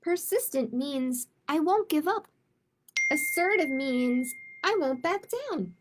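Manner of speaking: storytime read-aloud voice, gentle and clear; picture book read to kids; reading to children. Acted. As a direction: read it like storytime for little ones. Librarian reads picture book aloud.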